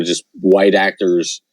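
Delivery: usual speaking voice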